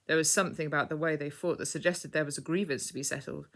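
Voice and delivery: plain reading voice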